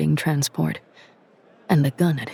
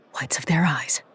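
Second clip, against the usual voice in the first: soft unvoiced whisper; whispered thought; 4 dB quieter than usual. A narrator's reading. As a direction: breathe it as a private thought. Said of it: "she thought".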